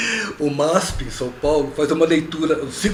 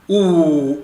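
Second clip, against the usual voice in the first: normal talking voice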